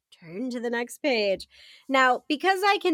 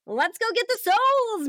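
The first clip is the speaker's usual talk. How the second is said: character voice; 10 semitones higher than usual. Acted. Character line in read-aloud adventure.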